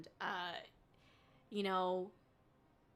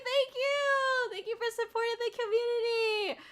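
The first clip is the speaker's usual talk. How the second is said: excited thanks; 11 semitones higher, 4 dB louder than usual. Real, not acted. Gushing thanks to gifter.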